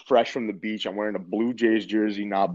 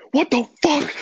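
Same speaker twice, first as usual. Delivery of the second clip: much higher, louder mock outburst; dad blowing up; 6 dB louder than usual. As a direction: burst out like a furious father on the phone. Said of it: "He started to go off".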